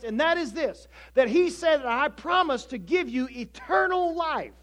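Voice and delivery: loud, high-pitched preacher's delivery; impassioned preaching. Real, not acted. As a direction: drive the point home at full voice.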